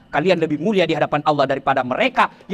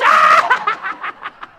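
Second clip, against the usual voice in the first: shrieking laugh, high and loud; high loud burst of laughter; 3 dB louder than usual. Retold or imitated. Preacher laughs at a five hundred bribe, high and loud.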